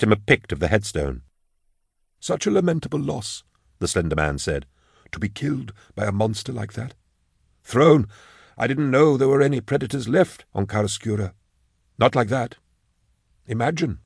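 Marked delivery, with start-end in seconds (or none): 2.2-3.4 high, soft funeral director's lament; softly mournful undertaker; condoling undertaker. Acted. Slender undertaker offers condolence. 5.1-14.0 higher, softened funeral director's voice; lilting, hushed undertaker amazement; gossiping condoling undertaker. Acted. Slender undertaker laments the death.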